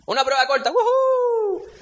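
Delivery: hammed-up student whoop, high and loud; mock celebrating student. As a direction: cheer like a student thrilled about a quiz. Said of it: "nadie sale celebrando".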